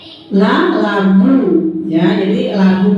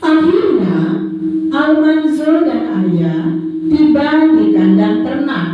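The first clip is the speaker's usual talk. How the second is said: put-on reading to a toddler; 5 semitones higher than usual. Reads a story to the children.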